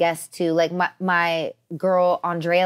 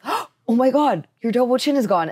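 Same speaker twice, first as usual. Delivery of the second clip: imitating shocked boyfriend; 4 semitones higher than usual.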